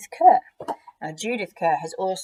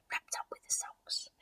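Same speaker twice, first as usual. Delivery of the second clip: whispered aside; hushed whisper; 13 dB quieter than usual. A narrator's reading. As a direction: breathe it quietly. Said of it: "whispered aside".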